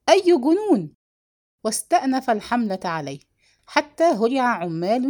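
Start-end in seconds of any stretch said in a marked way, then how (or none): 0.1-0.9 high, loud outcry; startled exclamation. A narrator's reading. Accused brother protests to his attacker, crying out. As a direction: exclaim it loudly, pitch jumping up. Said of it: "فهتف خضر".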